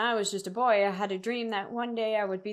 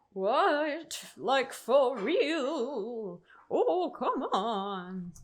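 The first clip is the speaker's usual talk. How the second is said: very slow, wavering ghost wail; 2 semitones higher, much slower than usual. Acted. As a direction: moan it like a ghost. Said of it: "That's my ghost voice".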